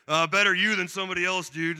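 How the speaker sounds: casual mock backhanded compliment. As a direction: toss it off like a grudging father-in-law. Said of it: "backhanded compliment comes out of".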